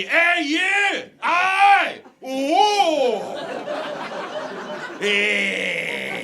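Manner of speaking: high, loud street yell in Scots; imitation Scottish shouting, all vowels; Glaswegian yelling. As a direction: holler drawn-out vowels like a Glaswegian. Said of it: "Guys yelling at me".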